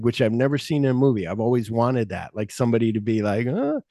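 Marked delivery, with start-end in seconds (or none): 3.4-3.8 very high scared grunt; mock fearful yelp; frightened uh. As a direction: squeak out a frightened uh. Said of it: "he's acting afraid".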